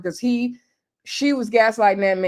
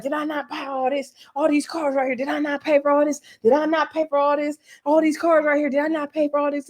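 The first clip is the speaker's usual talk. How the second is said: higher-pitched, insistent mock protest; imitated indignant demand; 4 semitones higher than usual. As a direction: act out his repeated indignant questions. Acts out his angry repeated demand.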